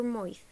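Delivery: "moith" with an oy vowel; Irish mouth as moith. Shown as a demonstration. Teacher showing Irish mouth, oy vowel. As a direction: say "moith" to rhyme with oy plus th. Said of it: "mouth can sound a bit like".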